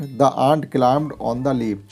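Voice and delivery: reads the English sentence very slowly, word by word; slow clear reading. Shown as a demonstration. Teacher reading an example sentence to pupils, very slow. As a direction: spell it out slowly for the class.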